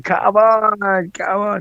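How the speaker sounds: high-pitched eager call, fading at the end; rally the tractors, up high then trailing off; urging them on. Retold or imitated. Cyclist urges the hundred tractors starting up, excited call. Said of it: "and I'm going".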